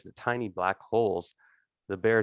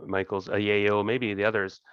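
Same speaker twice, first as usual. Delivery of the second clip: ordinary talk, nothing marked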